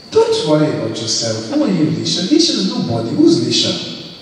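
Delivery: playful teasing. Acted. Pastor teasing a shy testifier.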